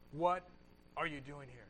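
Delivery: God's quiet question in the cave; low whisper of God. Acted. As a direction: ask it gently like a still small voice. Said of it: "the sound of a low whisper".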